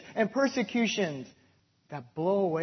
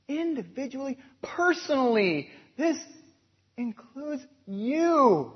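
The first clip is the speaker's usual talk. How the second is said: full-force preaching; 7 semitones higher, 6 dB louder than usual. Acted. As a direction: proclaim it to the congregation.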